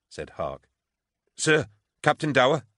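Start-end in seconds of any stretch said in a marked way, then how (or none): 1.4-2.7 high, loud, urgent hail; anxious call. A narrator's reading. Trooper hailing her captain across the hall, anxious. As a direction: call out anxiously over a crowd. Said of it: "a woman calling out anxiously".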